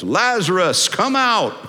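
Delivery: ringing, high-pitched call; loud calling. Acted. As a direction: call the man out with command. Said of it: "He calls in a loud voice".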